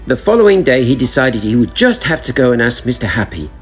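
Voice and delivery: plain reading voice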